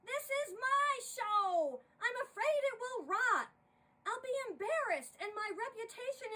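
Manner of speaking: loud, high, hysterical yelling; director shouting. Acted. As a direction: scream it like the show is collapsing. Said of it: "as the director continued to shout".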